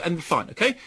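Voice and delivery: ordinary talk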